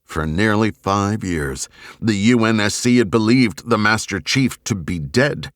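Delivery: ordinary delivery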